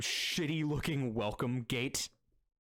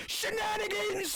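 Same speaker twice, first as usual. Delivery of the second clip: very high, slow, loud call; shrill call-out; 4 dB louder, slower than usual. Acted. Demon at the window calling shenanigans, very high and slow. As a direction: yell it from the window like an alarm. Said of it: "reach out and goes".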